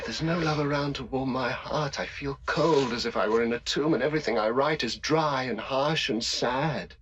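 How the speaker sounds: despairing plea. Acted.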